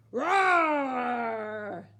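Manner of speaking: long, loud monster roar; troll roar. A narrator's reading. Troll furious at hoofs on his bridge, roaring. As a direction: let out a huge drawn-out roar. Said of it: "roared the troll".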